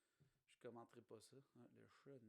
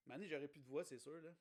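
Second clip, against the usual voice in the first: ordinary talk, nothing marked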